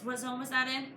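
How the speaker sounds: plain reading voice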